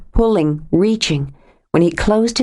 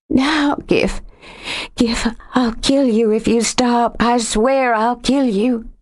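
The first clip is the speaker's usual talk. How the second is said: urgent; 6 semitones higher than usual. A narrator's reading. Lexi aroused urgency.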